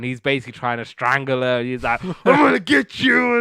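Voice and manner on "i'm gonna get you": high, loud shout of a threat; hammed-up furious yell; mock threat shout. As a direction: roar it like you're lunging at her. George trying to strangle her.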